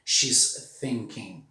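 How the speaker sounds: "thinking" with a breathy unvoiced TH; "She's thinking" with the tongue between the teeth. Shown as a demonstration. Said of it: "Outra forma de falar o T H".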